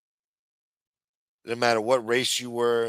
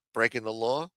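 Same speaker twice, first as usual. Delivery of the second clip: normal talking voice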